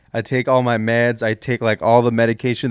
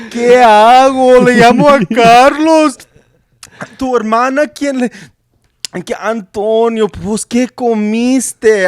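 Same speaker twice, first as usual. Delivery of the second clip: high, loud, frantic Spanish mother; panicked Mexican mom; 9 semitones higher, 8 dB louder, slower than usual. Retold or imitated. Mom woken at night, shrieking worried Spanish questions at her son. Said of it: "Can you do it in Spanish".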